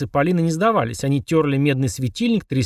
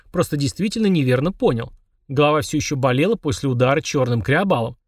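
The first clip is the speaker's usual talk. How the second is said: ordinary delivery, nothing marked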